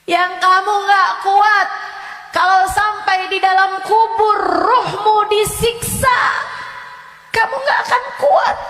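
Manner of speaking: loud, high, shouting warning; fiery preaching. Acted. Ustazah warning her listeners of torment in the grave, shouting.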